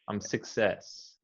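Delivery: "success" slow, first vowel a short schwa; schwa demo. Shown as a demonstration. Tutor models the schwa slowly for the student. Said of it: "I would say it more like the schwa".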